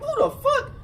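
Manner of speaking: very high, laughing outrage; who does he think he is; indignant disbelief. Retold or imitated. Sitting up in the bunk, high laughing outrage. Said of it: "So I'm like".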